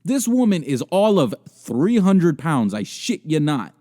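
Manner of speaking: high, loud, emphatic disbelief; appalled rant. Real, not acted. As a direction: hammer each phrase in scandalized amazement. Ranting in disbelief about her clothes.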